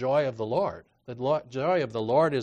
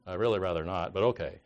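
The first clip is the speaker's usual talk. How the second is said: low, reluctant grudging consent; unenthusiastic, half-hearted drop in pitch; blah; 6 semitones lower than usual. Acted. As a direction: give in with mock reluctance. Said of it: "sort of a blah kind of a way".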